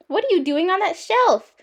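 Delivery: high-pitched, incredulous question; astonished father. A narrator's reading. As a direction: ask in sheer surprise. Father finds daughter on shelf.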